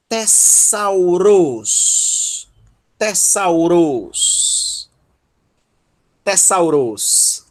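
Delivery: "Tessauros" very slow, weight on the last syllable "ros"; slow teacher's model of "Tessauros", stressed at the end; final-syllable stress. Shown as a demonstration. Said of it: "a última sílaba, que é a tônica".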